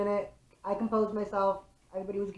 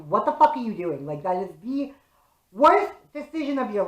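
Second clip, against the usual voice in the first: louder, angry retold yell at the boys; 3 semitones higher, 7 dB louder than usual. To the boys throwing a football at traffic, a louder retold angry yell. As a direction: bark it like you just grabbed the ball.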